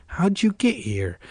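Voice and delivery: wary, drawn-out challenge; demanding. A narrator's reading. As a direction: demand an answer, drawing it out. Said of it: "Casey demanded".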